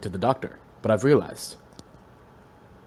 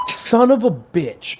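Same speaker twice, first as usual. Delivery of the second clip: annoyed outburst; 9 semitones higher, 5 dB louder, slower than usual. Real, not acted. Fire alarm goes off.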